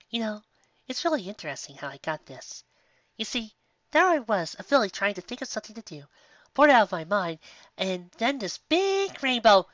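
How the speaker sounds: high, gentle motherly mare calling out; a kindly shopkeeper voice, lifted high; sweet baker mare. Acted. Baker calling to guests from the oven, sweet and high. Sweetie starts her story.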